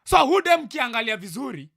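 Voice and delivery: worked-up, forceful complaint; angry rant. Real, not acted. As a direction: let anger push the voice up. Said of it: "why I'm so angry".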